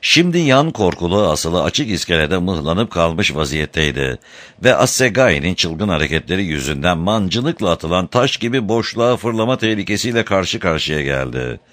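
plain reading voice

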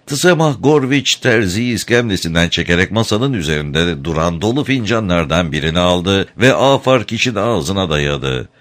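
ordinary delivery, nothing marked

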